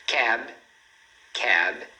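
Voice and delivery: CAB said alone, closed on the B; clean final consonant. Shown as a demonstration. Teacher shows examples of final consonants, correct pronunciation. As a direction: say "cab" low, with no vowel after the B. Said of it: "Então, olha só".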